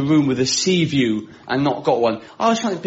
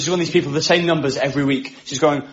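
normal talking voice

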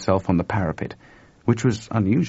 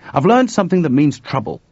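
urgent; 8 semitones higher, 7 dB louder than usual. A narrator's reading. Chuck voiced, urgent news.

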